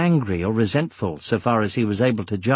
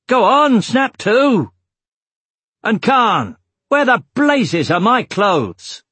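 loud, high-pitched shouted commands; shouted orders; 8 semitones higher, 6 dB louder than usual. A narrator's reading. Roran hurrying his men to finish with the wagons, shouting. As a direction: shout orders across a busy camp. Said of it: "then shouted".

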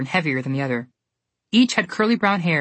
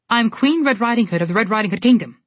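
indignant; 5 semitones higher, 4 dB louder than usual. Acted. Red angrily announces herself.

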